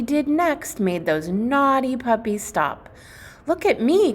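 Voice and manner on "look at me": much higher, louder call for attention; excited cry. Acted. The gingerbread boy showing off to the puppies, excitedly crying out. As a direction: show off to the puppies. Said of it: "Cookie cried".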